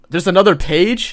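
loud, high incredulous question; surprised. Real, not acted. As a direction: blurt it out in disbelief. Surprised to find more story.

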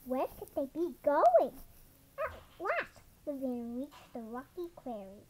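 ordinary delivery

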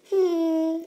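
usual speaking voice

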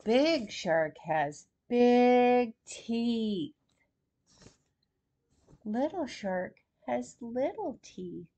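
ordinary delivery

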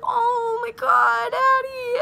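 high, slow, shaken oh my God; mock worried friend. Friend seeing Addie come out of the water, gasping in shock. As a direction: gasp her name in shock. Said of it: "And Nicole was like".

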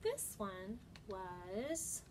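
animated storybook voice with dramatic pauses; reading to children. Acted. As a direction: read it like story time, building suspense. Reads picture book to children.

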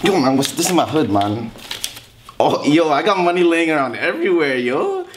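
hammed-up gangster boasting; fake tough gangster. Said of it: "It's your boy Jamal".

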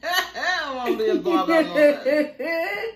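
slow, high, laughing through it. Real, not acted. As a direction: laugh out the words. Laughing refusal.

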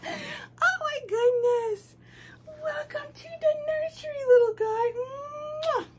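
very high gushing welcome. Real, not acted. Cooing welcome to new doll.

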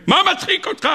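very high-pitched acted question; incredulous outcry. Sages asking Rabbi Akiva why he laughs, incredulous outcry.

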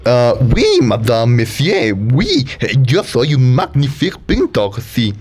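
put-on Italian accent that slides into French; hammy foreign painter voice; fake Italian accent. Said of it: "acento italiano parece más bien como francés". (acento italiano que suena a francés)

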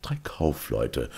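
plain reading voice

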